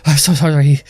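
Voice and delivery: high, loud and hurried hammed-up apology; lamenting. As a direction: throw himself at their feet wailing.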